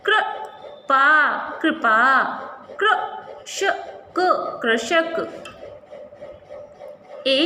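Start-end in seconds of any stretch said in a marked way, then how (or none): 0.0-1.6 कृपा drawn out very slowly as क्र-पा; teacher stretching कृपा; slow sounding out. Shown as a demonstration. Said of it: "इसका जो उच्चारण होता है". 2.7-3.8 कृषक drawn out very slowly, part whispered; teacher stretching कृषक; slow sounding out. Shown as a demonstration. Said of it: "इसका जो उच्चारण होता है".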